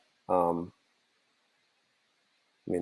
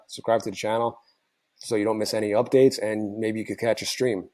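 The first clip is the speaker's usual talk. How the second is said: normal talking voice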